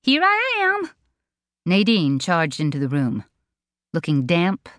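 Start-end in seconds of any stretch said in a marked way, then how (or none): 0.0-0.9 very high, bubbly sing-song greeting; overly chirpy cry. A narrator's reading. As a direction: burst in far too cheerfully. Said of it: "an overly chirpy cry of".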